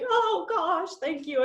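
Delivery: mock groveling; bow and thank meekly. Coach recalls thanking the universe for a goal, groveling. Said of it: "almost, like, groveling".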